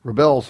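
"rebels" as re-BELS, weight on the last syllable; "rebels" with the stress moved to its second syllable; Hebrew-style stress. Shown as a demonstration. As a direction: repeat the slip "rebels" as ri-BELZ. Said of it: "pronounce it like Hebrew".

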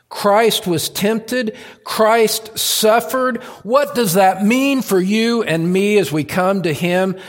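slow, high, ringing proclamation; impassioned preaching. Real, not acted. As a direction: drive each phrase home from the pulpit. Preaches at full force.

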